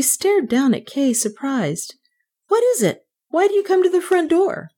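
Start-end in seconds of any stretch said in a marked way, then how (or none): 2.5-4.7 very high-pitched questioning maid; curious maid. A narrator's reading. Maid questions the child at the front door, curious. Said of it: "she asked with curiosity".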